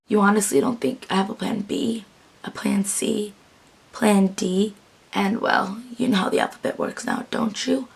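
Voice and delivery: soft, hushed delivery sinking toward a whisper; Captain impression. Fan recreating a plan B line for viewers, hushed impression. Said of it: "I didn't probably nail it. I did that recreation".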